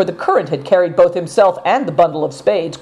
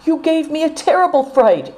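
put-on higher girlish voice, a touch reproachful; young girl's voice; 10 semitones higher than usual. A narrator's reading. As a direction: scold him gently in a young girl's voice. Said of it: "said Seven Stars".

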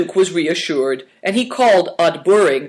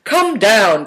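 loud, raised call; 6 semitones higher, 5 dB louder than usual. A narrator's reading. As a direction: call them down from above. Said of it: "cried Moonlight".

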